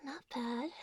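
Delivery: soft, breathy teasing whisper. Acted. Intimate teasing whisper to partner.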